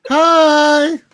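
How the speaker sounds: high, stretched-out mock greeting; fake-friendly hello. Co-host imitating the porch greeting, exaggerated fake-friendly. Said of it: "it was, like, the fakest voice ever".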